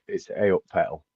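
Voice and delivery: Yorkshire "a up", first sound "ay", not "eye"; Yorkshire pronunciation demo. Shown as a demonstration. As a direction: say "a" in "a up pedal" as "ay". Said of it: "can I do that one for you".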